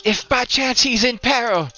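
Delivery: high-pitched little character; small sidekick, squeaky and earnest; little companion voice. Acted. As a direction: pipe up in a high put-on voice.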